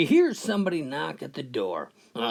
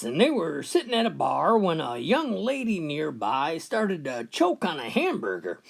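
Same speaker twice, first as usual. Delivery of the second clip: normal talking voice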